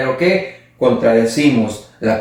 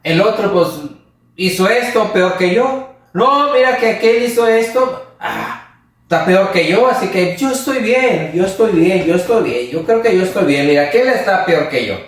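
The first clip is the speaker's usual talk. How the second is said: raised, excusing voice; self-justifying excuse; 5 semitones higher than usual. Retold or imitated. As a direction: convince yourself you are fine because others are worse. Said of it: "hacemos algo y decimos".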